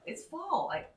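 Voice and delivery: ordinary talk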